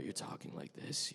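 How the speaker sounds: hushed, close-to-the-mic intimate read. Shown as a demonstration. Showing the audience a video game read, hushed and intimate. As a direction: drop into a soft, near-whispered confiding voice. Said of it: "really intimate sort of".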